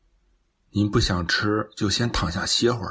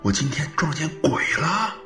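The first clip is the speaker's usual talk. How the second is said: baffled self-talk, pitch jumping up and dropping; talking to himself; 2 semitones higher than usual. Acted. Son alone at night recalling strange events, uneasy self-talk. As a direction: puzzle it out aloud with growing unease. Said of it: "自言自语".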